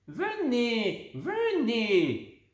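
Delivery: high beckoning call, lightly voiced; an old woman waving them over; calling old lady. A narrator's reading. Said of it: "appelle-t-elle". (appel de la vieille dame, voix à peine jouée)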